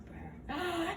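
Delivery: a sudden high gasp of shock; sharp gasp. Acted. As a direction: gasp as if catching someone out. Fairy startled by the lie.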